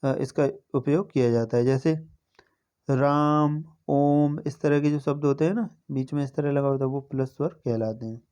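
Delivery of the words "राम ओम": "राम" with its vowel stretched out as if calling; prolonged calling vowel. Shown as a demonstration. Teacher showing how pluta vowels sound when calling someone, drawn out. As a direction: call out "राम" and "ओम", vowels held long. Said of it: "किसी को पुकारने में इसका प्रयोग".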